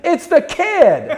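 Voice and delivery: high, loud, slow delighted recognition, laughing; laughing mock Aykroyd greeting. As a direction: cry it out like spotting an old friend. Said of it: "he goes".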